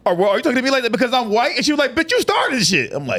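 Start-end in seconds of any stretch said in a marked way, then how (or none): 0.1-1.6 fast, high, affronted question; rushed high-pitched indignation; mock affront. Acted neighbour's indignant reply. 1.9-2.9 very high indignant retort; pitched-up accusing comeback; mock outrage. Acted angry retort in quarrel.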